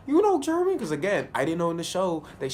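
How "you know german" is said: very high, loud disbelief; hammed-up amazement; astonished question. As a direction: blurt it out in mock shock. Acting out own surprise at German.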